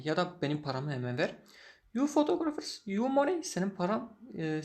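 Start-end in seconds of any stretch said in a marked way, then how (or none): 1.9-3.4 high, scornful echo of his words; mocking disbelief. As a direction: throw his words back at him. Photographer mocks the old man. (alaycı, yüksek perdeden tekrar)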